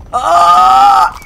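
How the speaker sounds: usual speaking voice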